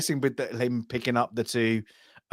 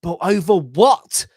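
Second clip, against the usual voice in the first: higher, louder and slower, baffled and exasperated; exasperated question; 7 semitones higher, 8 dB louder, slower than usual. Retold or imitated. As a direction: throw up your hands at the pointless feud. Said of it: "is like".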